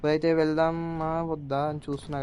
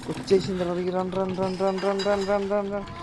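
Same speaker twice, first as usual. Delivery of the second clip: frantic rising run run; urgent scared calling out, climbing higher; panicked; 5 semitones higher than usual. Real, not acted. Ghost chasing, repeated run. (భయంతో కంగారుగా)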